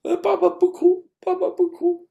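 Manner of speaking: put-on very high small-child voice; little daughter's voice. Daughter consoling her crying father, high childlike voice. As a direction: imitate a little girl soothing her dad. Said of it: "然后我女儿一看".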